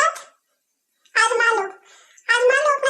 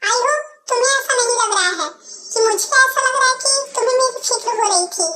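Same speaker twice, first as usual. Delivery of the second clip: usual speaking voice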